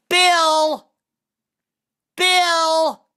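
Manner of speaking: high, loud, drawn-out holler; mock yelling. Woman calling the phone company, high mock yell. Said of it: "call up the phone company and just yell out".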